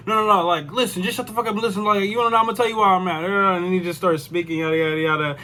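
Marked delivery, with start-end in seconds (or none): 0.1-3.2 mock trash talk. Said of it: "talking shit".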